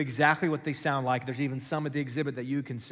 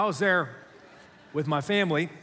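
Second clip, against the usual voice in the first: ordinary talk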